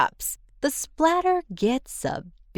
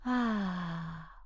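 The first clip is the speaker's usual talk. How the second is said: soft sigh; 9 dB quieter than usual. Acted. Sigh after crying at painting.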